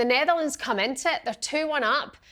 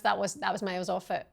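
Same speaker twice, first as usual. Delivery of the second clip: ordinary talk, nothing marked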